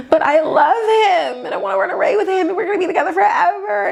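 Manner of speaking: high, rushed mock plea to Dad; hammed-up lovesick protest; lovesick pleading. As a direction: gush like a lovestruck kid, laughing.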